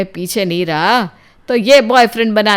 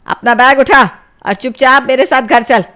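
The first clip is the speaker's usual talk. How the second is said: loud, raised-pitch scolding command; stern, loud mother's command; angry mother ordering; 6 semitones higher, 8 dB louder than usual. Acted. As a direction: order your child home, furious. Mother orders daughter home.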